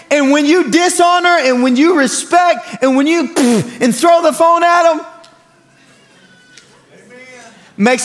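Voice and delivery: loud, high preaching to the youth. Acted. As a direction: drive the lesson home to the congregation. Preaching at full force.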